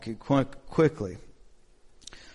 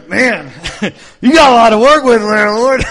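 loud, higher, laughing jab at himself; mock exasperation at the mirror; 8 semitones higher, 15 dB louder than usual. Preacher teasing his own reflection, loud mock exasperation. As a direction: throw it up to the Lord in playful disbelief. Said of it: "then I look in the mirror".

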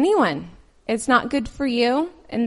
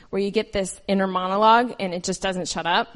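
usual speaking voice

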